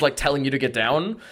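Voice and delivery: ordinary talk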